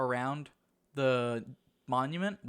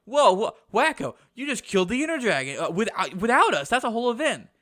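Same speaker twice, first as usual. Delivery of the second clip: high, loud amazed exclaiming; excited outcry; 7 semitones higher, 7 dB louder than usual. Retold or imitated. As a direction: quote the others' outrage with big astonishment. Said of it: "and everyone was like".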